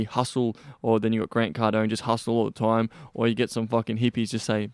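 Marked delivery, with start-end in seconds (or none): none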